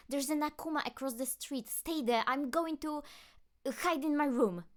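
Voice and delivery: urgent warning. Acted. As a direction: warn him quickly through the door. Marinette urgently warns Adrien.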